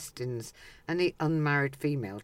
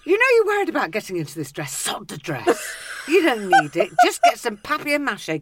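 excited; 8 semitones higher, 8 dB louder than usual. Real, not acted. Teasing over peacock dress.

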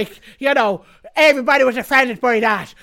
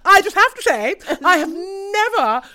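higher, louder, slowed mock of Piers; mock pompous Piers; 7 semitones higher, 5 dB louder than usual. Mocking Piers's speech on breakfast TV, high and pompous. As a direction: puff it up like a pompous presenter, dragging never. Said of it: "He was like".

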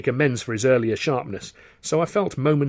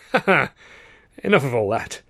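forced laugh; 3 semitones higher, 4 dB louder than usual. A narrator's reading. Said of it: "he said, laughs".

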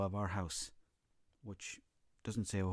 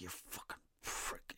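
hissed insult; 11 dB quieter, slower than usual. Retold or imitated. Angry brother insults speaker.

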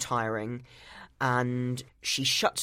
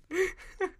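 squeaky burst of laughter; high giggle. Real, not acted. Laughing at a friend's impression. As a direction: giggle helplessly.

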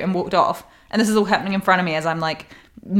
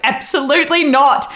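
defiant shout; 8 semitones higher, 7 dB louder than usual. Retold or imitated. Defiant retort to security.

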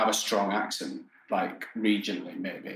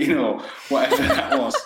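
laughing; 3 semitones higher, 4 dB louder than usual. Real, not acted. Laughing at own Greggs memory.

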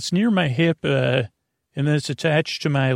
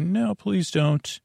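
pleading with himself; 5 semitones higher, 4 dB quieter, slower than usual. Retold or imitated. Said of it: "and I said".

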